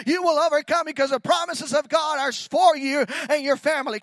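loud rising declaration, pressing each phrase; preaching at full force. Acted. Preacher shouting the promise to the church, full force.

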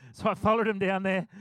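ordinary talk, nothing marked

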